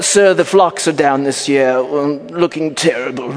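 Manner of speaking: hammed-up British butler; British accent. Butler telling his master the flocks are down, British accent. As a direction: trail into a slow, comic British accent. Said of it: "but he has a British accent".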